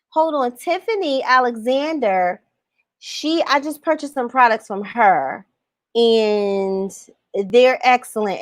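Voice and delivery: usual speaking voice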